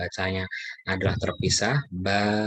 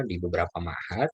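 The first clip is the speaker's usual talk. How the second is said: ordinary talk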